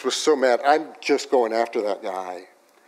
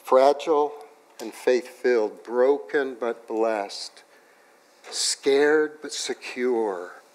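slow emphatic; slower than usual. Acted. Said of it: "sermon theme pairs, slow".

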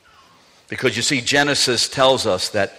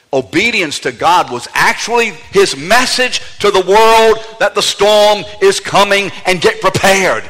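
higher and louder, rising fervour; building emphasis; 7 semitones higher, 5 dB louder than usual. Real, not acted. Preacher tells the congregation, higher and louder. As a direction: build the voice toward a shout.

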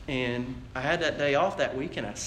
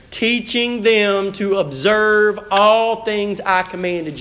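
louder, insistent pulpit voice, slowing on key phrases; preaching; 4 semitones higher, 6 dB louder than usual. Acted. Pastor urging the ladies of the church, full preaching voice. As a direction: urge the congregation with rising fervour.

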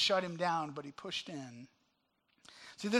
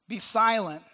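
louder, firm rebuke; rebuking crowd; 2 semitones higher, 8 dB louder than usual. Retold or imitated. Crowd rebuking the man crying out, loud rebuke. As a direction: silence him with a loud, firm order. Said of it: "many rebuked him".

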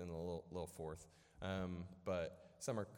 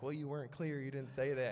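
high, louder imitation of an arguing sister; accusing retort; 7 semitones higher, 5 dB louder than usual. Sister blames brother for not being clear, high accusing retort. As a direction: throw the blame back, pitched well up.

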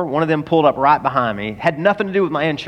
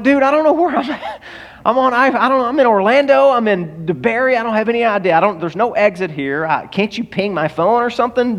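high-pitched flustered rambling, retold; frantic, lost; 6 semitones higher than usual. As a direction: act out helpless exasperation at the operator. Retold frantic call, lost on highway.

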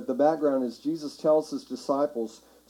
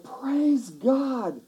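high, jubilant cry of praise; exultant acclamation; excited; 9 semitones higher than usual. Acted. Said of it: "They were excited".

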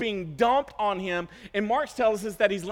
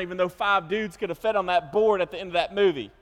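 usual speaking voice

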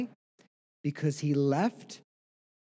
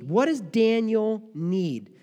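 "What does Daniel need?" drawn out slowly and higher, each word weighed; slow emphatic repeat; 7 semitones higher, 5 dB louder, much slower than usual. Acted. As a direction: let the question hang, stretching "need". Preacher repeats the question slower.

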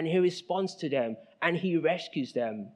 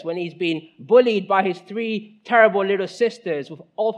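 ordinary delivery

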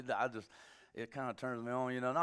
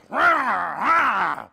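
loud, high burst of laughter; loud laughing outburst; big real laugh; 7 semitones higher, 14 dB louder than usual. Real, not acted. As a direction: laugh hard at the joke. Laughs after the bit.

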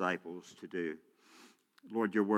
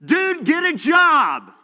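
loud, high, slow yell; mock street-corner scream much higher and louder; retold scream; 15 dB louder, slower than usual. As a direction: bellow it at the beggar. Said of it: "I'm screaming".

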